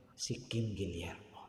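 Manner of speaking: hushed whisper of the name. Real, not acted. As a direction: breathe the name like a secret. Hushed reveal of successor's name.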